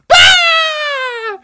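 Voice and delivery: long high scream of fright; startled scream. Acted. Librarian throws her books into the air, startled scream. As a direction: let out a piercing shriek.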